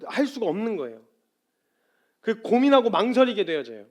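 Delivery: high, loud, urgent preacher voice; full-force preaching. Real, not acted. Preacher to congregation on the weight of a confession, forceful. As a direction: press it home at full volume.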